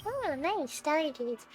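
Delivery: usual speaking voice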